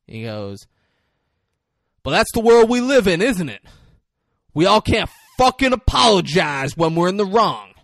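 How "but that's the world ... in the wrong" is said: rising menacing shout, higher and louder; Russell Crowe getting angry. Retold or imitated. Road-rage driver threatening the mom, angry loud shout. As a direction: threaten her, voice climbing into fury. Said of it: "he starts getting angry".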